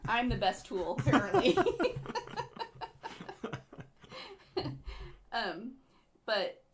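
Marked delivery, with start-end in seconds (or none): 0.1-1.6 laughing. Real, not acted. Jokes about being the tool. 1.6-3.8 unvoiced high laugh; breathy laugh. Real, not acted. Laughs at own joke.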